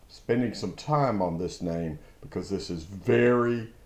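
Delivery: usual speaking voice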